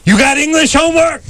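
high shouted drill sergeant bark; drill sergeant yell. Retold or imitated. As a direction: bark it like a drill instructor at a recruit. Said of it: "I yell".

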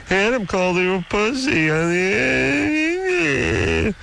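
very high, drawn-out mocking squeal; exaggerated, stretched-out playground taunt; mocking tattletale. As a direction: mimic a tattler in a silly high voice. Mocking people who tell tales.

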